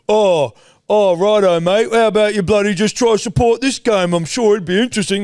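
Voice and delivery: high, loud, snide mocking voice; mocking sports fan. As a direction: needle him like a smug stranger in the stands. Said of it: "And he's like".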